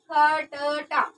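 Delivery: खट्टा drawn out very slowly in separate syllables; slow demo of खट्टा without the half ट; unjoined reading. Shown as a demonstration. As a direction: pull खट्टा apart, kha-ta-ta. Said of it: "एक दूसरे के साथ में ये नहीं जुड़ रहे।".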